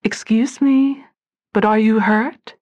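a hint of a melodic voice. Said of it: "A melodic voice rang out".

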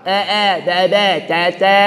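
be and ce pulled too thin and wide; teacher showing overdone letters; overdone vowel. Shown as a demonstration. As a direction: exaggerate the spread vowel. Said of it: "Itu berlebihan".

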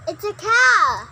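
sudden loud shout. Real, not acted. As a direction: yell it out to be heard.

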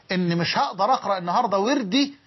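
usual speaking voice

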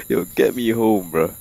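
ordinary delivery

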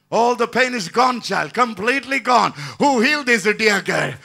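loud preaching cry; rousing shout. Real, not acted. Pastor rousing the congregation, loud shout. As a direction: call out to the whole church.

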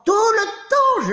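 high, drawn-out emotional cry; emotional outburst. Acted. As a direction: wail it out with overblown emotion. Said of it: "je parle avec l'émotion".

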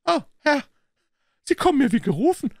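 high, loud, eager little man; excited cry. A narrator's reading. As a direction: cry out to a longed-for helper. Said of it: "rief der kleine Bursche". (aufgeregt rufend, hoch und laut)

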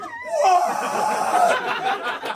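shrieked high, loud, laughing What; soldiers shrieking what. Retold or imitated. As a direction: squeal it like startled soldiers. Soldiers freaking out at balloon.

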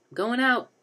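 high, loud, eager call; excited proclamation. A narrator's reading. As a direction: call it out excitedly to your teammates. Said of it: "Denny proclaimed excitedly".

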